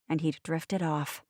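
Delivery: usual speaking voice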